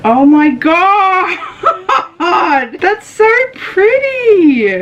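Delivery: very high, drawn-out excited squeal; real thrilled outburst, stretched and high; delighted squeal. Real, not acted. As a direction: squeal it slowly, laughing through the words. Overwhelmed on seeing the new hoodie.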